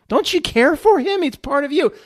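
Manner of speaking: high-pitched concerned questioner; pleading objector. Acted. Acting an objection to higher self.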